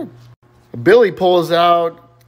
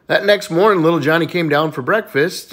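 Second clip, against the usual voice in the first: ordinary delivery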